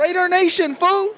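Raider Nation, fool, called out high; hype call. Real, not acted. Fan hyping Raider Nation at stadium, high hype call. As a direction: shout it like a proud fan.

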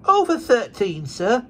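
put-on high, slow little girl voice; young girl. A narrator's reading. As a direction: answer like a shy young girl. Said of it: "replied the child".

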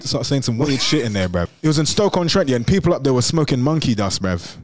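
ordinary talk

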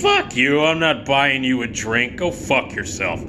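usual speaking voice

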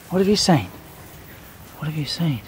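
usual speaking voice

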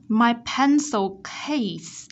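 slow "my pencil case", l and s endings clear; drawn-out teacher demo of "my pencil case"; model reading. Shown as a demonstration. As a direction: curl the tongue on the end of "pencil" in "my pencil case". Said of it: "uốn cong nhẹ lưỡi ở phía cuối từ".

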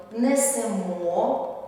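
несемо drawn out slowly, weight on the last syllable мо; teacher slowly models несемо with final stress. Shown as a demonstration. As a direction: stretch несемо, landing hard on мо. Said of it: "наголошуємо останній склад".

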